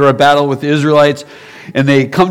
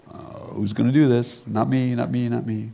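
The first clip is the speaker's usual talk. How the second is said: much softer nervous refusals; timid mumbling; 3 semitones lower, 11 dB quieter than usual. Acted. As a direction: shrink back and mutter, not me.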